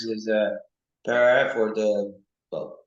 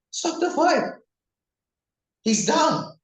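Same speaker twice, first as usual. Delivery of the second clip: much higher, urgent yelling; retold scream; 4 dB louder, slower than usual. Fan screaming that the knocked-down fighter is down, much higher. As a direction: shriek it like the fighter's hurt. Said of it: "And I was like".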